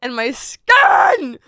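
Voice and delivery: drawn-out shouted complaint; exasperated shout. Real, not acted. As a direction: wail it out in frustration, stretching the last word. Exasperated outburst about bad skin.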